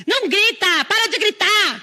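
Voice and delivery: high, loud mock shout; yelling mother. Mother shouting at fighting kids, high loud yell. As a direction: bark it like a fed-up mom.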